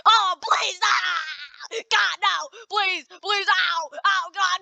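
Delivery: high, shouted cries for mercy; hammed-up terror, pitched high; panicked pleading. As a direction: beg and yelp as you go down. Pleading as plane goes down.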